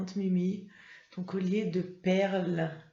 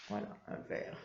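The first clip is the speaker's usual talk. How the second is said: whisper; 12 dB quieter, faster than usual. Real, not acted. Teacher drops to whisper.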